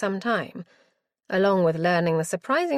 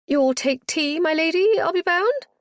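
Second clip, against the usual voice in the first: put-on high, fluttering, flustered old matron; 9 semitones higher, 5 dB louder than usual. Matron greeting her lady visitor, high and flustered. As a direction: gush over the guest in a high flustered voice. Matron fussing over guest.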